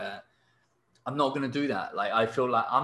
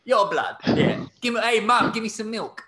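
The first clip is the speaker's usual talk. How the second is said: put-on higher, louder cheeky roadman kid; mock London kid; 7 semitones higher, 5 dB louder than usual. Teller mocking London kids, a cheeky high kid voice. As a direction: play a cocky little London kid asking his mum for milk.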